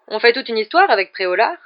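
grave, earnest tone. A narrator's reading. Prefect earnestly consoles a pupil left behind, grave. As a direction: insist solemnly. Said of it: "dit-il d'un ton grave".

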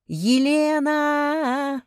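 long drawn-out high holler; full-voiced, slow bellow for help; yelling. A narrator's reading. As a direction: scream the name, stretching it out. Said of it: "заорала я".

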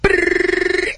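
high rattling gunfire sound with the lips; spray it like a cannon firing; machine gun noise. Retold or imitated. Carlos firing the helicopter cannon, high rattling gun noise. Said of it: "Call of Duty four mode".

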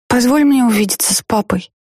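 put-on high childish plea; pleading little girl. A narrator's reading. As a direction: beg like a small child.

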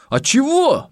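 high, protesting pupil; whiny protest. Acted. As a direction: object like a caught schoolboy.

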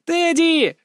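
put-on high-pitched excited girl's cry; excited call. Sister greets brother with a hug, excited high call. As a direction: call out his name high and eagerly.

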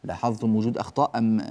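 usual speaking voice

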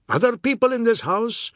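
high, loud indignant shout. A narrator's reading. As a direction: shout it through the house. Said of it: "Mister Biswas shouted".

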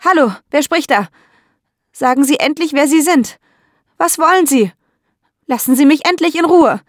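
high, loud frightened shouting into the phone. Acted. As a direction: yell at the anonymous caller to stop. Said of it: "und schreit". (laut und hoch ins Telefon schreien)

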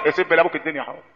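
low, soft aside; muted disapproving repeat. Real, not acted. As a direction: echo it quietly, dropping right down. (تكرار مستنكر بصوت واطي)

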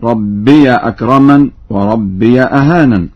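ربي as rabbiya, short a after the ya; opened ya. Shown as a demonstration. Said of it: "يقرأ بفتح الياء في لفظ". (ربي بفتح الياء)

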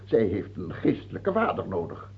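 ordinary talk, nothing marked

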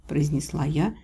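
plain reading voice

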